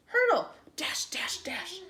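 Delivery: breathy whispered dashes. A narrator's reading. Reader voicing the bunnies in a whisper.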